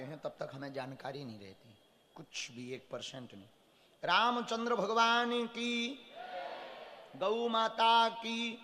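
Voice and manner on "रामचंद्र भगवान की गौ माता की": slow, high call-out to the crowd; jaikara call. Real, not acted. Guru leading the crowd's devotional jaikara, slow loud call. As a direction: draw out each word as a rallying cry.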